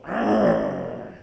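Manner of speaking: deep growling arr like a bear. Pretend. Sneaking up on his cousin, deep pretend bear growl. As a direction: growl low to scare her from behind. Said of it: "put my hands up on her back and go".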